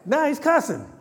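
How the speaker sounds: high, loud fervent preaching; preaching at full force. Acted. Preacher laughing about Peter cussing, full-force preaching. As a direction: shout it to the pews.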